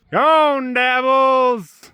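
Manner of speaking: high, carrying call across the yard; sing-song farmyard call. Real, not acted. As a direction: call the goose over from a distance. Calling a goose out of pen.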